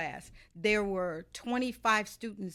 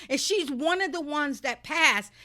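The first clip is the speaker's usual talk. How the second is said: much higher voice, slowing down, fed up; mock the teacher refusing her, pitched way up; exasperated teacher; 6 semitones higher, 6 dB louder than usual. Teacher refusing the student's retake request, high exasperated mock voice. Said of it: "He stood up and he's like".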